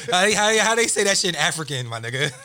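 put-on ignorant questioning, loud and amused; deliberately ignorant. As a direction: play up the clueless question for laughs. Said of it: "sound as ignorant as possible".

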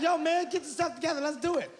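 egging a buddy on in a squeaky voice; mock tough-guy hype; hyped friend. Friend Naeem hyping him up.

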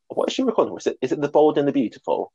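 usual speaking voice

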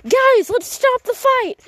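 high, slow yelled plea to stop; screaming. Retold or imitated. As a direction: shout high to break up a fight. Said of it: "I heard someone screaming".

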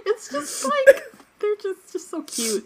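overwhelmed, laughing and crying; tearful laughing. Real, not acted. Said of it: "I was tearing up".

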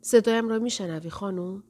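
plain reading voice

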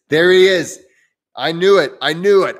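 host spots a teasing viewer in the chat, triumphant; caught you. Real, not acted. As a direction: call him out with glee, I knew it. Catching Papa Geek in chat.

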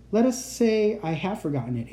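plain reading voice

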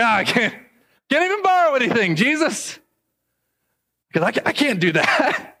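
high, fast whiny complaint; playfully whining at Jesus; mock exasperated self. Pastor whining to Jesus that funerals get canceled, high and fast.

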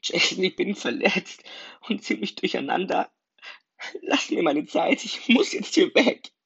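hurt and confused, holding back sobs; the voice cracking upward as she breaks off; tearful. Acted. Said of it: "ihr die Tränen von der Wange hinabliefen".

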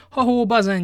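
high, loud call across the room. Acted. Aramis calls Bazin to come at once, loud hail. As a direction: hail the servant loudly.

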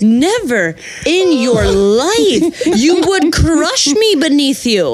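high, drawn-out indignation breaking into laughs; hammed-up outraged refusal. Turning down her date's advance, hammed-up scandalized refusal. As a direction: refuse theatrically, stretching each word.

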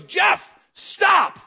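high, sharp shouted order; commanding yell. Acted. God yelling out the preacher's name, commanding yell. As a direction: stop someone in their tracks with a shout. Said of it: "When God yells out your name".

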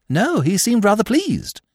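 high, breezy upper-class twit; chatty, airy young gentleman; silly young toff. A narrator's reading. Freddy answers Eve.

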